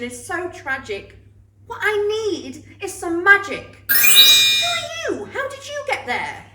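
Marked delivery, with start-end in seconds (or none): 3.9-6.5 startled. Acted. Girl startled by stranger.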